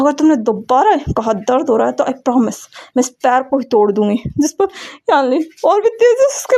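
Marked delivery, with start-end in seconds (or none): none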